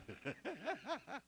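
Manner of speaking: soft, high chuckle; quiet laugh at one's own joke. Real, not acted. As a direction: let out a small laugh.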